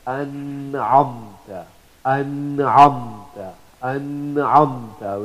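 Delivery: slow An'amta with the noon held clear before the ayn; clear izhar. Shown as a demonstration. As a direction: draw out An'amta, sounding the n fully and distinctly. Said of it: "with izhar".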